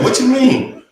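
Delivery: high, loud imitation of a baffled friend; retold bafflement.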